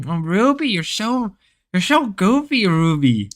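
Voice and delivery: high, gushing mock Grace teasing Ruby. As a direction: coo it high like Grace fawning over Ruby. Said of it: "the Ruby and the Grace voice".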